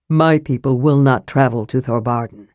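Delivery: a hint of a stern warrior; grim refusal. A narrator's reading. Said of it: "looked grim".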